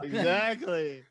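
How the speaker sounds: high, drawn-out through a laugh; amused, stretched-out delight; laughing agreement. Real, not acted. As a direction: agree while cracking up.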